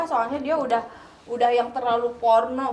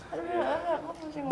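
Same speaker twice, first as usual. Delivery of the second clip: put-on drunk; 5 semitones higher, 11 dB quieter than usual. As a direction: pretend drunk, dizzy and groaning. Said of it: "Terus pura-pura mabok kan".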